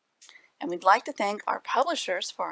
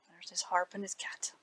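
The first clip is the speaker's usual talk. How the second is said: whispered aside; 9 dB quieter than usual. Real, not acted. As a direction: drop to a whisper, pointing at the picture. Aside to the listening children.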